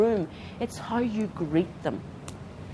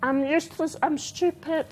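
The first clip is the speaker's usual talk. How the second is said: lightly voiced child in a higher pitch; high, put-down kid voice; self-deprecating kid; 9 semitones higher than usual. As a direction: speak up high as a kid who feels useless. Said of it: "So when a kid says".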